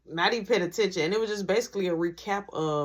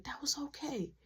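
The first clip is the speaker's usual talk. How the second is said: whisper; 8 semitones higher, 11 dB quieter than usual. Real, not acted. Recapping the day, hushed.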